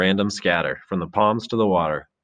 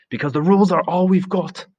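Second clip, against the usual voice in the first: high, loud plea; cling to the rules with everything; desperate insistence; 11 semitones higher, 5 dB louder than usual. Acted. Ralph pleading with the boys, high loud insistence.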